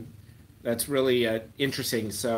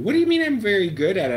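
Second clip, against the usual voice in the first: high, loud, quick disbelief; rapid incredulous protest; 8 semitones higher, 7 dB louder, faster than usual. Real, not acted. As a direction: question it in loud astonishment. Puzzled by viewer's claim.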